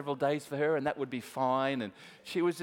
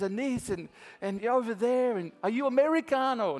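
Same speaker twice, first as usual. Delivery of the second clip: Filipino broken English; 7 semitones higher, 4 dB louder than usual. Retold or imitated. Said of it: "in her broken English".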